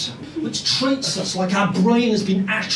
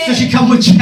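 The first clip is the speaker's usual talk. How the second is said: louder repeat; 3 semitones higher, 7 dB louder, faster than usual. Real, not acted. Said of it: "I'm glad I asked three times".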